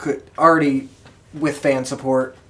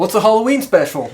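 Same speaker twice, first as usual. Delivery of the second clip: laughing; 9 semitones higher, 8 dB louder, much faster than usual. Real, not acted. Laughing answer to why they play.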